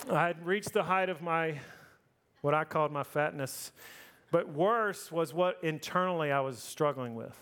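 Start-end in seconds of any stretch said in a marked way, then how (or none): none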